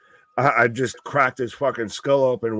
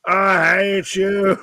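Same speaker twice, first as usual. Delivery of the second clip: mock Obi-Wan; 5 semitones higher, much slower than usual. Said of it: "Obi-Wan and Anakin".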